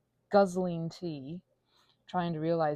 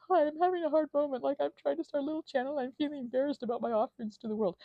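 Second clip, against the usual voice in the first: higher, small shy voice; imitating herself messaging; 8 semitones higher, 3 dB louder than usual. Imagined message to the ASMR artists, voiced small and shy. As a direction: sound timid and small. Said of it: "I was like".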